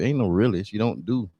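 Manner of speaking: usual speaking voice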